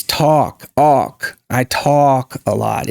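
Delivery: "talk" as tawk, vowel rounded; open, rounded vowel in "talk" and "awk". Shown as a demonstration. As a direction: draw out the AW in "talk". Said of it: "It's opened. It's more rounded".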